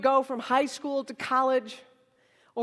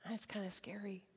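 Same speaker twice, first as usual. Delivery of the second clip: near-whisper, dropped right down; hushed confiding; 5 semitones lower, 19 dB quieter than usual. Real, not acted. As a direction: confide it very softly. Confiding the fear to the audience.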